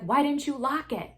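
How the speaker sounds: high, loud, accusing quote; mock scolding. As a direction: imitate an indignant friend snapping at everyone. Acts out Flo scolding the others.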